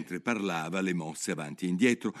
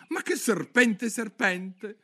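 high, indignant Alice protesting; outraged little girl protesting; 6 dB louder, slower than usual. Acted. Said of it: "disse Alice indignata". (Alice indignata protesta)

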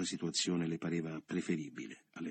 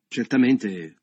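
firm and precise, louder; resolute; 5 semitones higher, 12 dB louder, much slower than usual. A narrator's reading. Leo opening his proposal to Carla, resolute and precise. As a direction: lay it down like a business deal. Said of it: "con quella risolutezza e quella precisione".

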